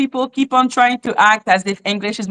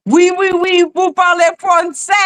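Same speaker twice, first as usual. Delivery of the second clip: high, breezy imitation of a French lady; a hint of a cooing French woman; mock French woman; 8 semitones higher than usual. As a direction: lightly mimic a Frenchwoman's gushing. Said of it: "a woman that was like".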